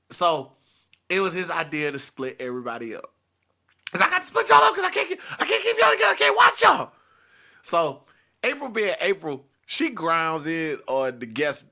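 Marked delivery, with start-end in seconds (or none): 3.9-6.9 high, loud, frantic shouting; furious grandpa rant. Retold or imitated. Grandpa threatening to tell on the kids, high shouted rant. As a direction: shout in a strained high voice, piling up complaints.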